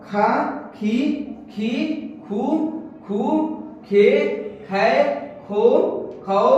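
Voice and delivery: slow syllable-by-syllable drill of क का की कु and ख खा खी खू; reciting syllables. Shown as a demonstration. Teacher drills the class through the barakhadi, syllable by syllable. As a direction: chant each syllable क, का, की, कु as a separate clear beat. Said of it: "जिस तरह से व्यंजन वर्ण का वॉइस आएगा".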